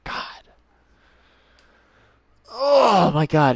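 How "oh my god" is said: slow, loud drawn-out groan; exasperated groan. Real, not acted. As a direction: drag it out, fed up. Exasperated after wasted search.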